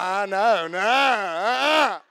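loud, slow drawn-out mock refusal; imitated refusal. She won't agree with us, imitated drawn-out refusal. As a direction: stretch the no out loudly, imitating her. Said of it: "She's like".

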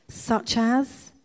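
"Such as?" with a rise at the end; questioning. A narrator's reading. Corrie asks her sister what to thank for, questioning. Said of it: "she inquired".

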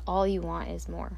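ordinary talk, nothing marked

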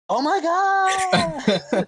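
very high, loud mock squeal; hammed-up fangirl shriek; sudden gushing excitement. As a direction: squeal like a starstruck fan, stretched out. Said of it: "Total change of personality".

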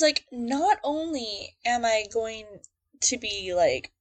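usual speaking voice

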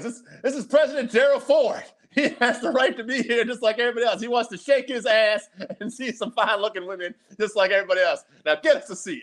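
high, loud indignant speech; high-pitched comic outrage, easing toward the final demand; Eddie Murphy. Retold or imitated. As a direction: make a scene on a friend's behalf. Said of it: "Eddie Murphy's like".

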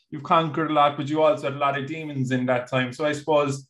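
normal talking voice

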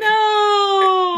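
high loud no; mock anguished no; anguished cry. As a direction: cry it out in despair. Acts out Leia's grief.

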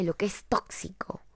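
hushed confiding whisper; whispered aside. Real, not acted. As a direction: lean in and whisper the point. Confides a point.

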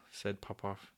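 usual speaking voice

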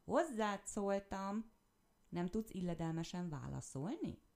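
loud, slow, indignant rebuke; annoyed scolding. A narrator's reading. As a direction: scold the rude stranger. Angry hare scolds. (felháborodott, hangos szidás)

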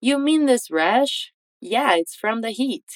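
slow, rising question then falling answer; slow model dialogue. Shown as a demonstration. Teacher reads James's full reply slowly for students. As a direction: read the whole reply slowly for learners. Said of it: "Repete depois de mim".

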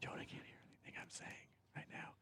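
muffled whisper behind the mic; whisper behind covered mouth. Real, not acted. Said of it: "My mouth is covered by the microphone".

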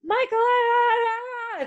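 very high, loud mock scream; hammed-up terrified shriek; screaming re-enactment. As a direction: scream it like a panicking kid. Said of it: "he's just like that".

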